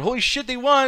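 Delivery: high, loud excited yell; crowd cheering. Retold or imitated. Voicing the crowd's pop.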